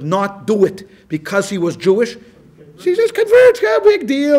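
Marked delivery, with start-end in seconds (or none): 2.8-4.4 high, loud mocking shrug, big deal; dismissive mock voice. Lecturer mocking those who shrug off conversion, high and loud. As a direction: toss it off like it means nothing.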